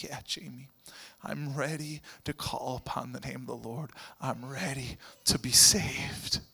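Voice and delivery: choked reply; tearful whisper. Retold or imitated. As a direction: whisper through the tears. Philo in tears answers.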